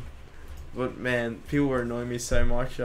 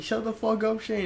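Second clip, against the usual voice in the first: retold annoyance; 9 semitones higher than usual. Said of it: "he just did till he got overly annoyed".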